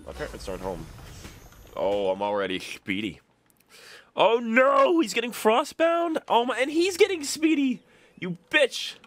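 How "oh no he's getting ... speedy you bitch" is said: very high, loud alarm; high-pitched frantic outburst; panicked gamer yelling. Real, not acted. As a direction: squeal in dismay as the enemy gains on you. Alarm as opponent gains speed.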